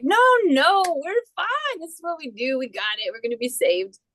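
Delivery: high breezy "No, no" fading to calm assurance; carefree refusal, settling down; cheery denial. Retold or imitated. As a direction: brush off the rescuers carelessly. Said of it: "And you're like".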